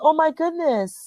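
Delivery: ordinary talk, nothing marked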